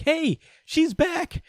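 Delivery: high, slow sing-song call of joy; excited call. Retold or imitated. Niece gleefully announcing her return, high slow call.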